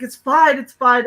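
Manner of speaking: retold flustered reassurance; hurried reassurance. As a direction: wave off a panicking friend. Brushing off the friend's panic.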